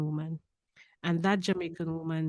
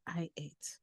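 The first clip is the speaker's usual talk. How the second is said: barely voiced I ate; whispered; 14 dB quieter than usual. Real, not acted. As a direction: breathe the words softly. Tender memory, whispered.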